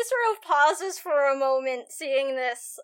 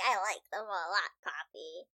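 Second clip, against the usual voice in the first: soft, low and gentle; tender; 6 semitones lower, 7 dB quieter than usual. Acted. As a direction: say it softly to a flower. Ro softly praises the daisy.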